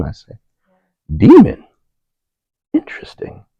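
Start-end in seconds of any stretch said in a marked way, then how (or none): 1.1-1.7 high, loud incredulous "Demon?"; surprised. Retold or imitated. Told a demon lives in him, startled. 2.7-3.4 hushed, breathy "Interesting"; a private wry whisper; whispered aside. Retold or imitated. Reacting to the demon claim, whispered.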